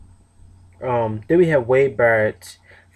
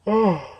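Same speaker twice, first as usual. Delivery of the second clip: yawn; 9 semitones higher than usual. Real, not acted. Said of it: "Excuse me for yawning".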